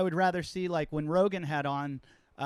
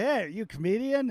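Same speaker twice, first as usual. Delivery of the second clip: a higher, eager "Hey, are you a comedian?"; eager question; 6 semitones higher than usual. Retold or imitated. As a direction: ask it delighted, as if the person just made you laugh. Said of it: "I'm like".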